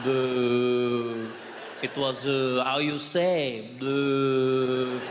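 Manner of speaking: drawn-out low hesitation, stalled on every filler; mock French dithering, slow and low; hesitant French. Comedian showing French lacks confidence, hesitant drawl. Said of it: "there's not enough confidence in the language".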